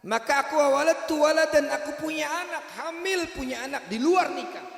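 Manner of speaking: high, loud anguished confession; woman confessing to Musa. Acted. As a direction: pour out the shameful story.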